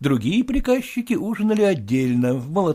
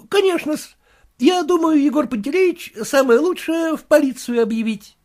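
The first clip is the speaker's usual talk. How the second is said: much higher, fussy clerk's voice; clerk reporting; 13 semitones higher, 4 dB louder than usual. Acted. The clerk tells his master about the drunk neighbour, fussy.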